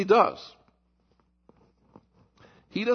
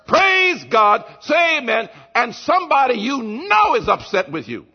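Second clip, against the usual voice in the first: loud, high challenge; preacher thundering Jesus's rebuke; preaching shout; 6 semitones higher, 6 dB louder than usual. Acted. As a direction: shout the question at the congregation. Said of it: "He says".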